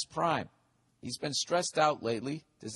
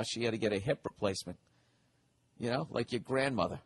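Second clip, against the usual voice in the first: usual speaking voice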